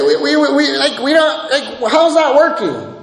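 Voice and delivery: high-pitched, stumbling protest; people's protesting response. Acted. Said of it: "we see the people's response here".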